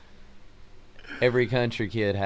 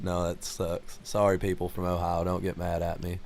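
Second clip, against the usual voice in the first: normal talking voice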